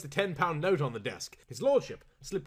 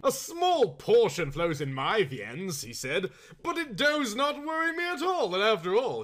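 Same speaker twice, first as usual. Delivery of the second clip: aristocratic Earl; 7 semitones higher, 5 dB louder than usual. Acted.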